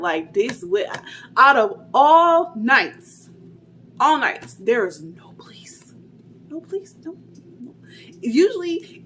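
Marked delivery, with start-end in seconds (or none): 1.4-4.5 exasperated. Retold or imitated. Exasperated retelling of no police. 4.5-7.7 hushed whisper. Retold or imitated. Telling her friends no police came, whispered.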